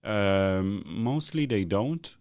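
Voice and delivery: ordinary delivery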